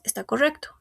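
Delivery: ordinary delivery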